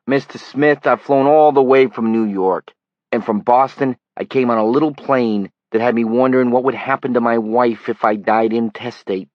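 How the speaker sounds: put-on a tabloid reporter pleads his long trip, loud Brooklyn accent. A narrator's reading. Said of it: "He had a flat city accent as well".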